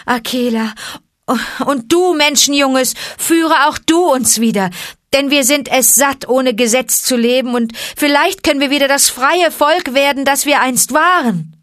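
loud, high, pleading wail; plaintive howl. Acted. A ragged wolf begging Akela, loud and plaintive. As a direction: beg the leaders to come back. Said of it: "heulte mit klagender Stimme".

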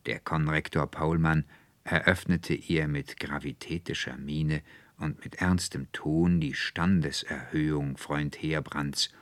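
ordinary delivery

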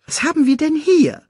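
hohe, fragende Timon-Stimme; neugieriges Erdmännchen. Acted. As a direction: neugierig und hoch untersuchen. Timons Figurenstimme. (hoch und neugierig)

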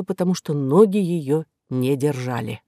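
ordinary delivery, nothing marked

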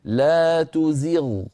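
slow, the sakin غ of تزغ a step heavier; Ibn al-Jazari recitation. Shown as a demonstration. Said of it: "كيف يكون نطقه هكذا".

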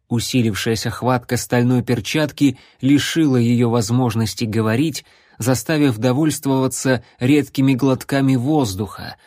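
ordinary delivery, nothing marked

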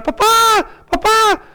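put-on high-pitched child calling out; crying child. Frightened child calls his papá at bedtime, mock crying. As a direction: imitate a small child's cry for dad. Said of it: "el niño empezaba a llorar".

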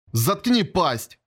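usual speaking voice

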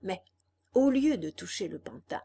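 ordinary delivery

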